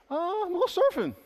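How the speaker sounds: high, fast and breezy; happy-go-lucky mock reply; carefree. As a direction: toss it off like nothing's wrong. Acted carefree believer answers.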